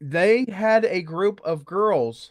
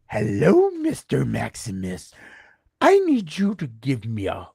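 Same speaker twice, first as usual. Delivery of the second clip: creepy voicemail voice. Retold or imitated. Leaving a creepy voicemail for the association president. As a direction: menace him playfully into calling back. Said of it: "And that was the voicemail".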